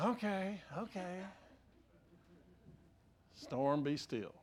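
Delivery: a soft, unbothered hint of Jesus calming the storm; lightly voiced calm Jesus. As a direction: quietly settle it, no fuss. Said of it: "I can just see Jesus kind of going".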